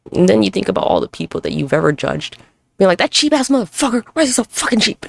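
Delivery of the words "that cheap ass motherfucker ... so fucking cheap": higher-pitched acted indignation; imitated griping, pitched up; mock judgmental. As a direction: complain about someone as if judging them. Voicing a past judgment of others.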